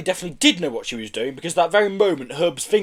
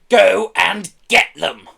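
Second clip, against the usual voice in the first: slow, loud, raised order; threatening bark; 5 semitones higher, 7 dB louder, much slower than usual. A narrator's reading. Pelly ordering Herb after the Kellys, barking. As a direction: spell out the command menacingly. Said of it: "Pelly barked threateningly".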